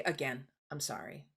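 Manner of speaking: soft humble apology with quiet resolve; gently promising to do better; sincere apology. Shown as a demonstration.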